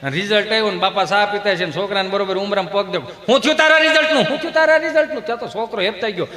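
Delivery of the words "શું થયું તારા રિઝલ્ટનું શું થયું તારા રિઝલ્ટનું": high, raised interrogation; mock stern dad pouncing; demanding father. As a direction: fire the question at a son. Father demands result from son.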